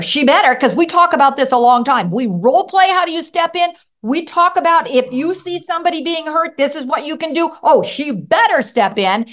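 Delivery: a proud father insisting his daughter will act; adamant. Retold or imitated. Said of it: "He becomes the most adamant parent".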